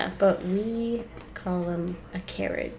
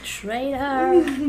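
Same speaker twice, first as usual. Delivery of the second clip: mock outrage; 6 semitones higher, 4 dB louder than usual.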